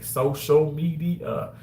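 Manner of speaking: "social media" drawn out slowly; emphatic. Real, not acted. Streamer proves apps count to viewers, "social media" slowly emphasised. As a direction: stretch "social media" syllable by syllable.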